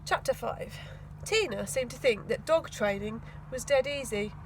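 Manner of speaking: usual speaking voice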